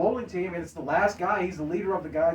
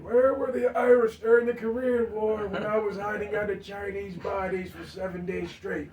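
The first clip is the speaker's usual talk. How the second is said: a hint of an angry old war veteran, slightly higher; old bowling captain lightly voiced with a grudge; mad old veteran; 7 semitones higher than usual. Bowling team leader yelling at the leprechaun, mildly angry old man. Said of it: "he's mad at the Leprechaun".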